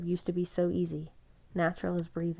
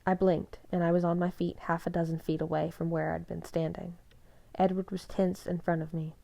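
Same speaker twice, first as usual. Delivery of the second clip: ordinary delivery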